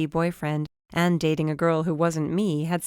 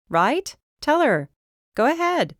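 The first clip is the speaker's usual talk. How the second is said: higher, rising coax to the dog; 10 semitones higher than usual. A narrator's reading. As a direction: egg the dog on. Urging the dog to agree.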